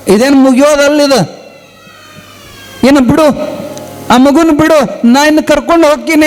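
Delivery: impatient sadhu. Retold or imitated. Sadhu hurrying the mother.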